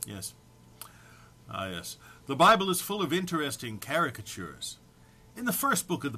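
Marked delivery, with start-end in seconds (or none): none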